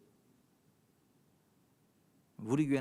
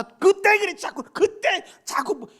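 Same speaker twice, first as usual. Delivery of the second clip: high, loud exasperated exhortation; full-force preaching; 10 semitones higher, 12 dB louder than usual. Acted. Preacher urges spouses to forget old mistakes, full-force preaching. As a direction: hammer the congregation to let it go.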